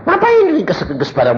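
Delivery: high, brushing-off retort; retold dismissive retort. Husband answers wife's worry about packing, high dismissive retort. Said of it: "Aku bilang".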